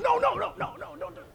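high mock-alarmed cry of no, sliding into a laugh; hammed-up ushers begging them to stop; panicked ushers. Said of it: "The ushers are going".

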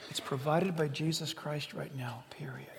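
restrained whispered proclamation. Real, not acted. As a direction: hold back the yell and whisper it. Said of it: "I wanna yell right now but I'm not going to".

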